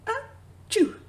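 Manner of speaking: loud high mock sneeze. Acting the chilly cat from Brazil, loud high sneeze. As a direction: burst out a big cartoon achoo.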